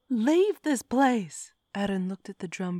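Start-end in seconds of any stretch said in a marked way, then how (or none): 0.1-1.5 slow, high and loud command. A narrator's reading. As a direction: drive him out word by word. Lacey ordering god out.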